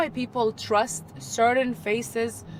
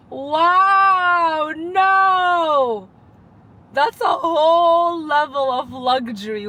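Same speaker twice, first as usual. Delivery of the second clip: higher and louder, a delighted disbelieving cry; excited shout; 6 semitones higher, 7 dB louder, much slower than usual. Real, not acted. Amazed her car offers her a break, shouting. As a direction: shriek with joy at the luxury. Car asks if she's tired.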